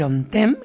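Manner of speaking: ontem said OM-tem, stress on the first syllable; Portuguese ontem. Shown as a demonstration. Host showing kids the Portuguese word ontem, stressed first syllable. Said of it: "ontem que es el ayer".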